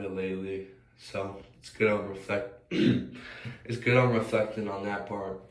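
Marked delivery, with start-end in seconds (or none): 2.7-3.6 throat clearing. Real, not acted. Speaker reflecting on doom scrolling, clearing throat.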